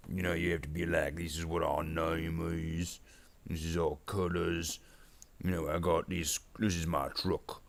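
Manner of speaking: self-important orc boss. Pretend. Orc boss explains orc life to stream, orc voice. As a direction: chat away as an orc explaining himself. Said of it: "doing this orc voice".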